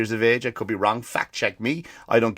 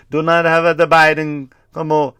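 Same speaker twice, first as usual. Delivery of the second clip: raised, loud mock-Italian voice; Italian accent; 4 semitones higher, 6 dB louder than usual. Dying Pope refusing a visitor, Italian accent. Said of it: "he's Italian in this".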